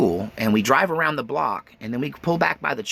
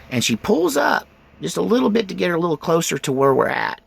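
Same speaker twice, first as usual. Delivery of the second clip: normal talking voice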